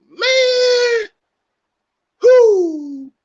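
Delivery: high far-up joyful whoop; excited whoop. Real, not acted. Vlogger relishing Nicky D's stand, high joyful whoop. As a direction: let out a thrilled holler.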